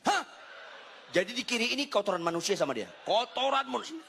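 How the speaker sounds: high, aghast comic outcry; hammed-up horror, pitch climbing; mock disbelief. Husband appalled by wife's hygiene worry, mock disbelief.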